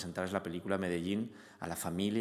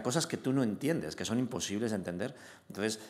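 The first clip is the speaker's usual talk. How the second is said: usual speaking voice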